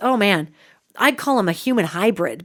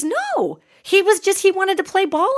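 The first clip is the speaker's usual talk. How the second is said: imitating grandma; 10 semitones higher than usual. Grandma voiced answering about fear.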